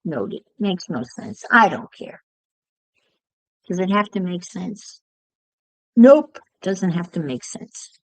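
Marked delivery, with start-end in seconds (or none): 5.9-6.4 emphatic nope. Real, not acted. Emphatic answer to own question.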